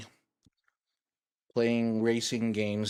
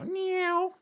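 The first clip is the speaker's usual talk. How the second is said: vroom noise. Pretend. Car swerving sound.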